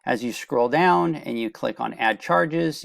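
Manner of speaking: ordinary delivery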